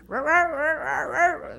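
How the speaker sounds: high, quick croaking; high frog croaks. Acted. Frog asking other frogs about its rear, high croaks. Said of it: "le preguntaba".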